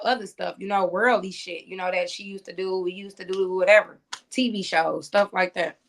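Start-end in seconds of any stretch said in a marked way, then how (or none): none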